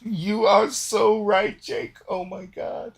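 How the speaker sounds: high, drawn-out gleeful gushing; Delgar in hysterics. Acted. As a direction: gush it slowly in a very high voice. Delgar laughing his ass off.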